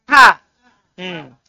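ordinary talk

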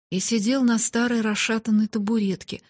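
ordinary delivery, nothing marked

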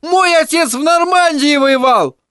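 высокий мальчишеский крик; ори, защищая отца; орал. Acted. Тедди кричит дальше. (Тедди орёт на Майло)

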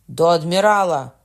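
a firm first cry to the admiral; shouting. A narrator's reading. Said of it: "гукнув Марвель".

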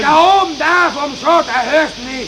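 loud high shout. Acted. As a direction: yell over hissing steam. Shouting over steam noise. (laut schreiend)

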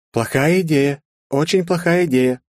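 high-pitched warning, rising on the repeat; alarmed exclamation. A narrator's reading. Assistant warns the hero before his jump, alarmed cry. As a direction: cry out to stop a reckless move. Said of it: "воскликнула Кэрол".

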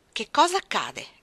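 slow, loud commanding question; the mistress demands an answer; imperious. Acted. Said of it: "chiese una voce imperiosa". (la signora Reed chiede imperiosa, lenta e forte)

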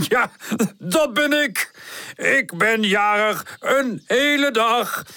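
beam with birthday glee; jolly wise father. Acted. Wise father greeting the friends, jolly. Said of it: "De wijze vader komt er vrolijk bij staan".

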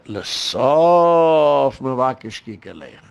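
"sof" held very long and loud; drawn-out Talmudic emphasis. Retold or imitated. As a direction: stretch out "sof" like a learner expounding Gemara. Quoting and expounding a Gemara line.

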